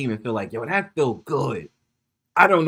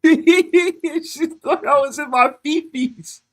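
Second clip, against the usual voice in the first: laughing; 10 semitones higher, 6 dB louder, much slower than usual. Real, not acted. Laughing at chatter's worry.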